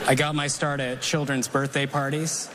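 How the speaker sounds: ordinary talk, nothing marked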